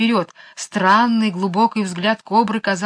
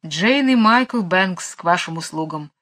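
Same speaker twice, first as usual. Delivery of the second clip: usual speaking voice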